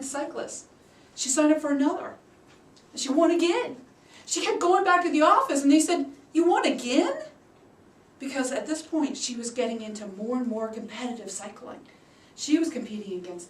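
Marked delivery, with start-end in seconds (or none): 6.3-7.1 high, loud disbelief; amazed coworkers. Retold or imitated. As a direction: ask it like you can't believe she won.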